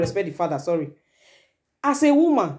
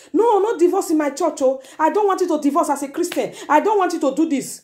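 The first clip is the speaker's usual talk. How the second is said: lightly acted pastor's objection, pitched up; higher, emphatic mock refusal; imitating a church leader; 6 semitones higher than usual. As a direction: insist like a pastor forbidding divorce, high. Mimics pastors forbidding divorce.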